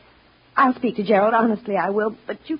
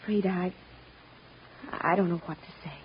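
abashed apology; 7 semitones higher, 13 dB quieter, faster than usual. Acted. Mother embarrassed before housekeeper over son, abashed. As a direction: falter quietly, at a loss.

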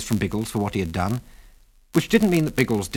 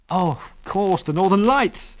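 high-pitched dawning recognition; sudden realisation; 9 semitones higher, slower than usual. A narrator's reading. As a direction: let the answer dawn, voice lifting high. Ginger realises what the lights are.

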